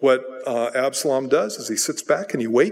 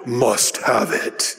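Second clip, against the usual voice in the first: greedy grasper; 4 semitones lower, much slower than usual. Retold or imitated. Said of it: "they're like".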